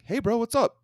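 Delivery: put-on salesman's American-bro accent, pitched high and loud; breezy high-pitched American accent pitch; standard American accent. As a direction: imitate a vendor hailing you in standard American accent. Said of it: "just standard American accent".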